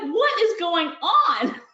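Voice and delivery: high, slow, baffled what is going on; bewildered. Retold or imitated. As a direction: stretch it out in disbelief. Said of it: "it took me by surprise".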